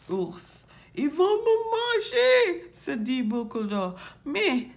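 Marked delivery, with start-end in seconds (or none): 0.9-2.7 very high, loud panicked cry; frightened. Acted. Boucle d'Or fears the bears, high panicked cry. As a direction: wail it high and loud in alarm. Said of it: "elle a peur".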